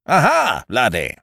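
lightly voiced boisterous chum, high and loud on the hail; hearty Ukridge. Ukridge hails his visitor as he enters, hearty.

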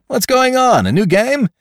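very high, loud, excited question; jaunty newcomer. Acted. Man takes the fight for a game, excited.

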